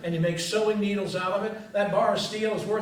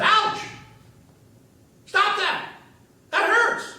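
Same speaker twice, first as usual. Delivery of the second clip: high, loud, whiny cry of protest; whining piece of wood; 6 dB louder, slower than usual. A narrator's reading. Ebony wood whines at its carver, high and loud. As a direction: squeal like a wood block being carved. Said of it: "the stick kept fussing and whining".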